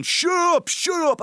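high, loud mock crowd yell; imitated bar shouting. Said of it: "everybody in the bar just was shouting at me".

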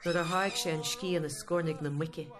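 rhythmic Irish storyteller narration; Irish language storytelling. Acted. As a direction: tell it like a fireside shanachie.

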